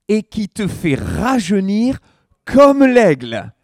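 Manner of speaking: slow, loud declamation rising in pitch; emphatic proclamation. Real, not acted. Pastor declaiming a psalm to the congregation, emphatic proclamation. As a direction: proclaim it slowly, building to a high, ringing peak.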